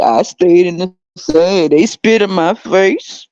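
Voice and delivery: slow, drawn-out complaining elder; mock baby boomer. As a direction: drag out the old grievances slowly. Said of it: "they be like".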